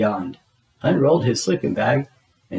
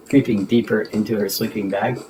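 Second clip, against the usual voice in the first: ordinary delivery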